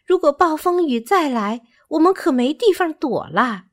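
high-pitched small bird voice; worried bird. Acted. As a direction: pipe up with concern about the storm.

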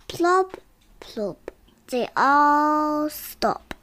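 sing-song storybook reading; reading to a toddler. Acted. Child reads picture book aloud.

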